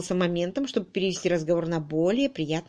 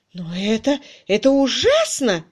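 higher, louder shocked cry, slowed; dismayed exclaim; 5 semitones higher, 6 dB louder than usual. A narrator's reading. Miss Twitterton crying out over her uncle's debts. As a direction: gasp it out in dismay. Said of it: "воскликнула мисс Твиттертон".

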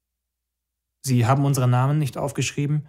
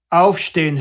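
loud, higher bellow; officer yelling at the kneeling man; roared order; 5 semitones higher, 7 dB louder than usual. Acted. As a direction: bellow the command. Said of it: "hörte ich jemanden brüllen".

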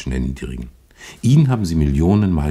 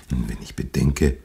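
ordinary delivery

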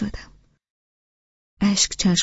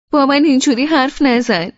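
higher and louder, hurt snap at him; annoyed; 8 semitones higher, 7 dB louder than usual. A narrator's reading. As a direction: tell him off in a sharp, offended burst. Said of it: "با حرص گفتم". (با حرص و دلخوری، بلندتر و زیرتر)

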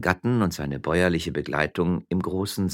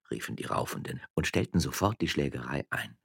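plain reading voice

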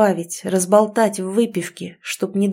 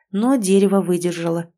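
usual speaking voice

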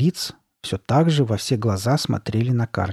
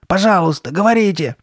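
high, loud, urgent plea; pleading; 8 semitones higher, 6 dB louder than usual. A narrator's reading. Girl begging for the answer, desperate plea. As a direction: implore with raised voice. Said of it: "взмолилась она".

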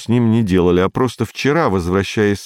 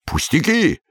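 cheerful; 6 semitones higher than usual. A narrator's reading. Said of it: "бодро произнёс Петенька".

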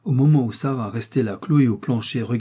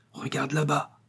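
voix basse et rauque; 4 semitones higher, 6 dB quieter than usual. A narrator's reading. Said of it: "d'une voix basse et rauque".

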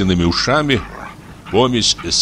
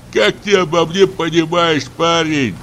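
high, plaintive wail of protest; moaning; 9 semitones higher than usual. A narrator's reading. Forester protests to the insistent hunter, high moaning voice. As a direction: moan as if cornered. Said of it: "простонал лесник".

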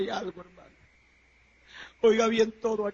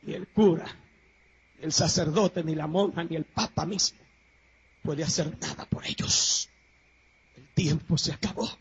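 grave low sermon voice; 5 semitones lower than usual. Acted. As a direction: drop it low and solemn. Sudden drop to low voice.

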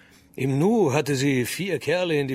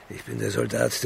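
ordinary delivery

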